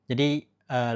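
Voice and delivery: ordinary delivery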